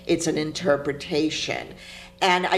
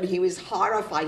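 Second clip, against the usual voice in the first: ordinary talk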